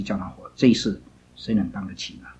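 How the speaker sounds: normal talking voice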